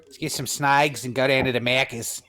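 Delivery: broad Aussie slang delivery; Australian accent. Acted. Co-host trading Aussie slang, broad Australian accent. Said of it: "an Australian accent".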